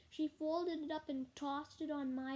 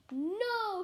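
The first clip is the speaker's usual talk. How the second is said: a loud, higher group shout; 5 semitones higher, 4 dB louder than usual. A narrator's reading. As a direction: yell it back as a class. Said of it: "shouted all the girls".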